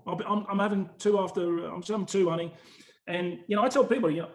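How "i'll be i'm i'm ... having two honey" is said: breezy Essex promise, clearly put on; essex accent. Voicing the drinking lads' promise.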